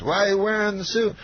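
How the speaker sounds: a light Cosby voice; Cosby impression. To the young comic, a light Cosby voice.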